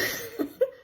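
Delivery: a quick amused giggle; high laugh. Real, not acted. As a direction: laugh at her doubt. Laughing at skeptical colleague.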